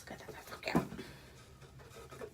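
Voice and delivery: hushed unvoiced whisper; awed whisper to the camera. Real, not acted. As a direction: breathe it softly in awe. Whispering at the banner.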